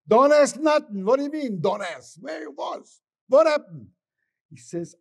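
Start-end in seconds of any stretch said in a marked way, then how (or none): 0.1-3.9 high, loud exasperated questioning; acted indignant outburst; insistent demand. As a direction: badger a friend for news, pitched way up. Friend insists on hearing the news.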